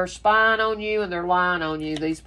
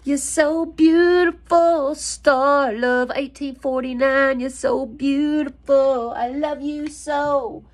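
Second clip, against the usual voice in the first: higher, slow, sweet lover's voice put on; doting lover's voice; 6 semitones higher, 3 dB louder, slower than usual. Reader voicing the man adoring his twin flame, slow and crooning. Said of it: "He said".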